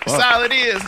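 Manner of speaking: high, loud catchphrase; excited. Acted. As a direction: belt the catchphrase. Show catchphrase repeated.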